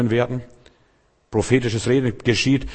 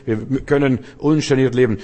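usual speaking voice

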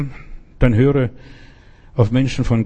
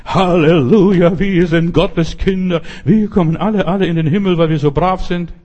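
mocking pious churchgoers; 5 semitones higher than usual. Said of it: "Die hören sich Predigten". (spöttisch nachgeäffte fromme Gemeinde)

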